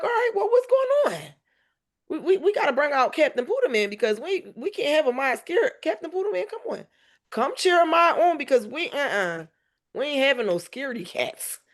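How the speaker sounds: rally him with playful urgency; excited pep talk. Retold or imitated. Mom hyping up scared son.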